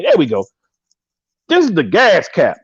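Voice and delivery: ordinary talk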